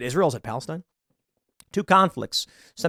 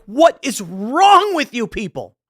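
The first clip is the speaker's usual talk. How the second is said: higher, louder, slow exasperated demand; 7 semitones higher, 5 dB louder, slower than usual. Retold or imitated. Scolding people spamming tweets, exasperated. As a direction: throw up your hands at them. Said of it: "And I'm like".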